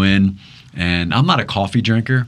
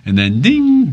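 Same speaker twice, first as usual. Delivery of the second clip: a high, louder sung ding; mock warning chime; 10 semitones higher, 5 dB louder, slower than usual. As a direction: imitate the fuel light's ding. Imitates the gas warning chime.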